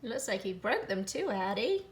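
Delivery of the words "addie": plain reading voice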